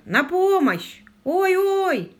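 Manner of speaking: high, carrying holler; drawn-out cries for help; obedient shout for help. A narrator's reading. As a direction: call out dutifully so he can find you. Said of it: "послушно закричал лорд Питер".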